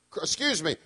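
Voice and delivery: ordinary talk, nothing marked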